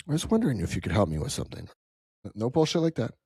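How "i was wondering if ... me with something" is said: low, quick mock polite request; a hint of a stuffy caller; prim fancy opener. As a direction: rattle off the stiff formal opener. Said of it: "no fancy opener".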